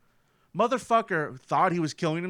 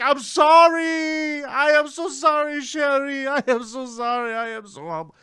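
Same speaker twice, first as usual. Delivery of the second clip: mock sobbing apology; 10 semitones higher, 5 dB louder than usual. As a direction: blubber the apology like a grown man crying. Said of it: "and he's just crying".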